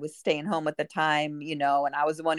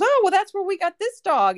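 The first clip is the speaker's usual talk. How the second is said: high, louder retold delight; excited quote; 6 dB louder than usual. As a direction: gush it like a happy surprise. Stranger's delighted reveal, retold.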